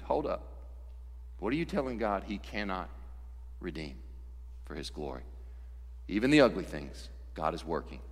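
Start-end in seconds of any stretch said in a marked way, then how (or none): none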